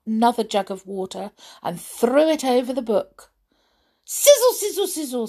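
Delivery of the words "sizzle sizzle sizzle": high, voiced hiss of flames; sizzling flames. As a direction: say it as the fire dying. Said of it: "said the flames again".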